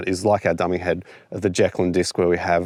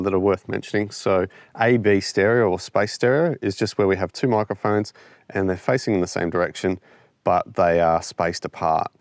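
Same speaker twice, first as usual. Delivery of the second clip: ordinary talk, nothing marked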